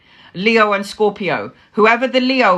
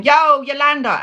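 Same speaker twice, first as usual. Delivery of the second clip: retold joking scream; 6 semitones higher, 4 dB louder than usual. Mother bursting into daughter's room, joking mock scream. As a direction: holler it as a joke. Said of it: "I'm screaming".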